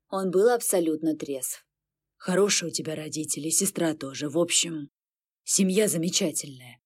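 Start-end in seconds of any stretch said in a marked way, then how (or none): 2.2-6.8 lightly voiced demon. A narrator's reading. Sober demon speaks quietly.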